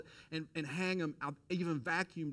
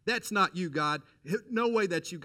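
loud, high, insistent protest; flatly refuse, raising the voice; loud denial of what he heard; arguing loudly; 6 semitones higher, 9 dB louder than usual. Retold or imitated. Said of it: "I started arguing with God".

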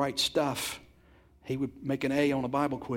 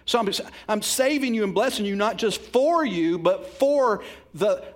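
preaching at full force; 6 semitones higher, 6 dB louder than usual. Real, not acted. Preaching climax.

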